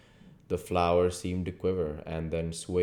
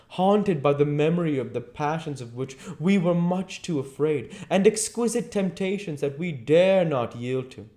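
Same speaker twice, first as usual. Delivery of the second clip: higher, louder voice of a languid, persuasive aristocrat; Lord Henry's voice; 11 semitones higher, 6 dB louder than usual. Acted. Worldly lord urging young Dorian to seize his youth, persuasive.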